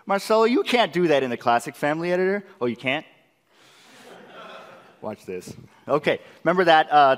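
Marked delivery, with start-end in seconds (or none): none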